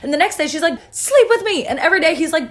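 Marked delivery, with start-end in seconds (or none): 0.9-1.7 high-pitched, pushy demand; mock exasperated insistence, pitched way up; insistent demand. Potiphar's wife pesters Joseph once more, insistent.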